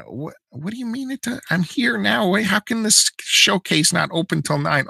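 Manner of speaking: high-pitched, disbelieving customer; incredulous protest. Retold or imitated. Customer told the case opens at nine, incredulous.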